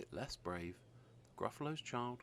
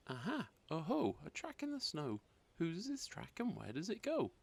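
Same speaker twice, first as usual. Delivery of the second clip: put-on higher, eager child voice; excited discovery; 7 semitones higher than usual. Gruffalo's child spotting a track, eager child voice. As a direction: call it out pleased.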